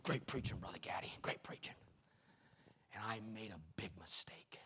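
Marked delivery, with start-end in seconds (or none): 0.0-1.7 whispered praise. Retold or imitated. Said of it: "He goes".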